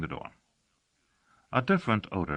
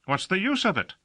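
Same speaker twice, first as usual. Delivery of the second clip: a much higher, pressing demand; insisting; 13 semitones higher, 4 dB louder than usual. Acted. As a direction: press her hard for an answer. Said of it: "insisted Casher".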